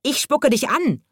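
high-pitched llama screech; kreischend. A narrator's reading. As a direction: screech the threat, pitched well up. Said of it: "kreischte das Lama". (hoch gekreischt, wie ein Lama)

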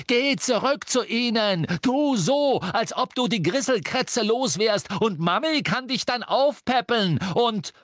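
high, loud, mocking rage; furious, taunting shouted rant; thundering tirade. A narrator's reading. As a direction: keep roaring until cut off. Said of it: "donnerte Harry".

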